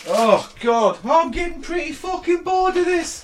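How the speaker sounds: high, slow, grumpy ghost; a bored spirit moaning. Acted. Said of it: "Please stop with the ghost voice".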